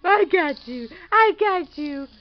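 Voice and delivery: loud, gleeful catch; excited. Acted. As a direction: crow it as you catch him. Catching baby in game.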